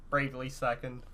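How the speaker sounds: ordinary talk, nothing marked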